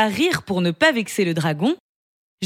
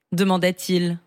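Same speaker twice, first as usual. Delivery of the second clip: ordinary delivery, nothing marked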